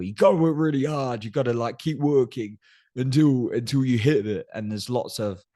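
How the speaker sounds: mock hustle guru. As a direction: hype the listener like a grind coach.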